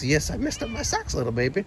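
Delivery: very high cooing pet voice; high sing-song pet talk. Real, not acted. Cooing at the cat.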